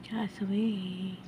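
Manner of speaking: ordinary talk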